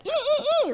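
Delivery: put-on high excited squealing like a child; high kid squeals. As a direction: squeal like a little kid picking cereal. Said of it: "like a little kid in the store".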